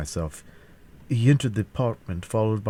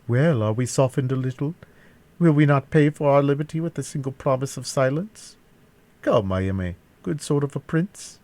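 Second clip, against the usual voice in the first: higher-pitched mock-coaxing villain; taunting captor; 7 semitones higher, 3 dB louder than usual. A narrator's reading. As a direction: coax the prisoner in a raised voice.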